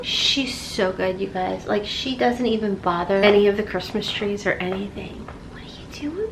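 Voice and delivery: hushed whisper so the cat stays calm. Real, not acted. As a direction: whisper it under your breath.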